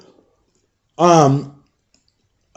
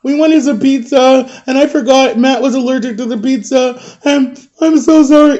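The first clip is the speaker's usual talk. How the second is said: mock crying; 8 semitones higher than usual. Friend thinks he's dying and apologizes, imitated crying. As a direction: imitate a sobbing kid owning up. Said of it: "he's, like, crying 'cause he thinks I'm dying".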